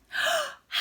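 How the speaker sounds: sharp high gasp of an idea; sudden gasp. A narrator's reading. Snail gasping as the idea strikes. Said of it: "then cried".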